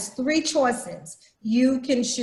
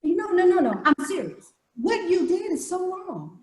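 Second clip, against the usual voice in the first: higher, earnest reality show pleading; mock reality drama, pitched up; reality show protest; 7 semitones higher than usual. Shown as a demonstration. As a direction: protest like a hurt reality star. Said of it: "goes back to the reality".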